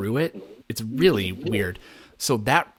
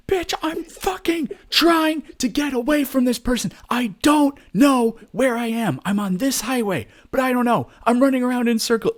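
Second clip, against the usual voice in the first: high, louder panicked shouting at the operator; frantic yell; 13 semitones higher, 4 dB louder than usual. Retold or imitated. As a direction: yell like you're being chased and can't think. Panicked caller yelling at dispatcher.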